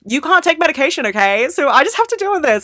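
high, loud, worked-up protest; fed-up outburst, high and forceful; exasperated venting. Real, not acted. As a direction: vent it loudly, pitched right up. Venting about living without medication.

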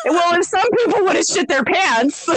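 high, incredulous mock retort; mock exasperation. Babysitter answering kids' cookie demand, exasperated. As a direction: snap back in comic disbelief.

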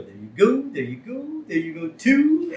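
each phrase swooping up high at the end; sing-song handing-out. Retold or imitated. Mimics Burns bagging puppies.

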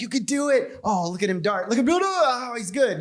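high, excited cheering at the TV; root for the gazelle like a fan; mock sports fan urging the gazelle to escape; cheering the prey. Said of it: "I'm always like".

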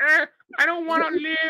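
high, put-on voice of a suicidal cat; despairing cat voice. The cat mocks its owner on the ride home, in a high put-on whine. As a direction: whine it like a cat who has given up.

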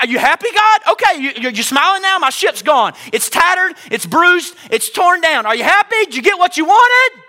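high, loud accusing cry; resentful shouted accusation; bitter shout at God. Retold or imitated. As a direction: hurl it at heaven. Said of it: "in that moment".

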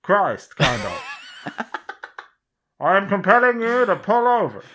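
high mock announcement, laughing; through a megaphone. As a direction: call out over a police loudspeaker. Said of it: "megaphone like a police car".

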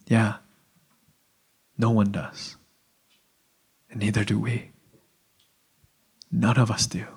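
soft, low reply with long pauses; subdued admission. Acted. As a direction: concede it quietly, phrase by phrase. Acting Jesus's subdued admission of unworthiness.

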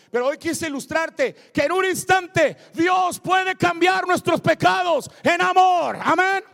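loud proclamation climbing to a shout; full-force preaching. Real, not acted. Preacher proclaims the risen Christ to church, shouted preaching.

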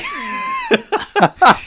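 high, loud burst of laughing; burst of laughter. Real, not acted. Laughing at his own impression of his flustered mum, high burst of laughter. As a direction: crack up at your own impression.

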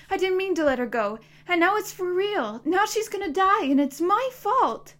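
loud, high outburst; voice rising. A narrator's reading. Boy protesting to the monster, voice rising loud. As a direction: cry it out desperately. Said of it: "his voice rising".

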